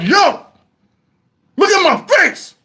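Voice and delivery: high, urgent; alarmed plea. Retold or imitated. Stung man urging his wife to see his face, alarmed.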